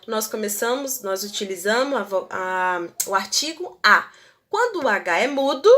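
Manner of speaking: usual speaking voice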